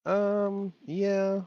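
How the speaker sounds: very high, drawn-up doubtful yeah; hesitant agreement. Real, not acted. Man answering a friend about corn, high and doubtful. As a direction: agree reluctantly in a high pitch. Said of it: "Why are you saying it like that?".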